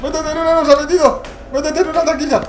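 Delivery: high, loud panicked shouting at himself; frantic pleading. Real, not acted. Streamer hiding from the monster, frantically shouting to hide. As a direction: beg and scream to get in the locker.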